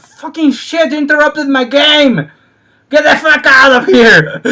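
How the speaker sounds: high, loud angry ranting; mock gamer rage. Gamer to the power-cutters: high mock rage. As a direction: rant like an interrupted gamer. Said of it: "we will be like".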